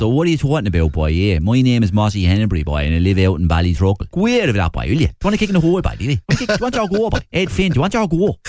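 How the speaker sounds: very guttural Waterford accent; lively Waterford local, pitch leaping on the challenges. Shown as a demonstration. Mossy from Ballytrockle taking on the host, guttural Waterford. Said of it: "It's, it's very guttural".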